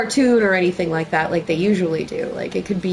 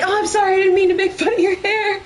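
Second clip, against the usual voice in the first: high, laughing mock apology; apologetic actor; 9 semitones higher, faster than usual. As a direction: say sorry half teasing. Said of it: "he's like".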